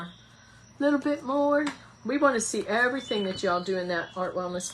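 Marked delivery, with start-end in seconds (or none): 0.0-1.7 slow playful voice. Pretend. Repeat in slow put-on voice.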